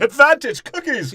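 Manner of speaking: high, loud cartoonish announcement; hammed-up, high-pitched proclamation; mock triumphant call. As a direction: shout it like a mock victory cry. Acting out ape handing out cookies.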